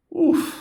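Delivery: a sharp high "uf" of dismay; a high, sudden sigh of exasperation; exclaimed uf. A narrator's reading. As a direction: blurt out a pained "uf". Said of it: "exclamó".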